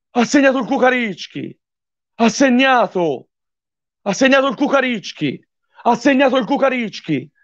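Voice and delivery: high, thrilled repeated cry; excited goal call. Real, not acted. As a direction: keep shouting the goal. Goal celebration live on stream.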